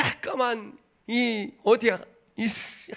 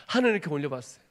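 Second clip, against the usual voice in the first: ordinary talk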